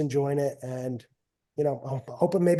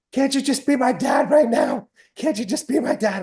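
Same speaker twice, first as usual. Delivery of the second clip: higher, pleading daughter voice; imitating crying daughter; 8 semitones higher, 4 dB louder than usual. Daughter after a lost match pleads with her father, higher and pleading. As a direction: beg to just be her dad. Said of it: "She's like".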